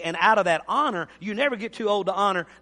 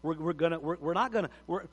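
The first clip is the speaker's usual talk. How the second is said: put-on softer, low and measured warning to a child; quiet firm parent; 6 semitones lower, 9 dB quieter than usual. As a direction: calmly lay down respect for your father. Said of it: "the other parent will say".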